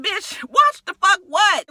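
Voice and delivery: high, slow challenging shout; indignant retort. Retold or imitated. Viewer snapping back at Kenya's threat, indignant retort. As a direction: throw it back in disbelief.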